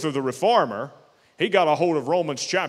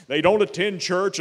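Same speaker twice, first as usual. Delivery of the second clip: usual speaking voice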